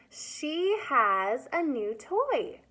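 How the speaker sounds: slow, clear model sentence. Shown as a demonstration. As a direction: say it slowly for children. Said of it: "Can you use has in a sentence?".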